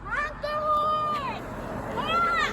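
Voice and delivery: slow, loud, high call. Real, not acted. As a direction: draw it out to the driver. Calling to trucker, slow.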